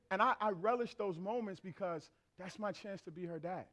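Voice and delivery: ordinary delivery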